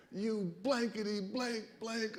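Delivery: mock yell. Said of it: "he starts yelling at me".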